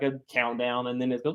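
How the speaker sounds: ordinary talk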